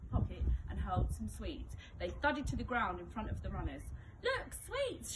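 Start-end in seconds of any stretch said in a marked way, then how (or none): 4.2-5.1 high, excited mock shout. A narrator's reading. Henry tricking the runners, high mock shout. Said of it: "shouted Henry".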